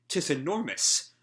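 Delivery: a touch of an archaic, stately warrior; a hint of a solemn knight; lightly voiced formal swordswoman. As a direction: pronounce it like a stiff knight.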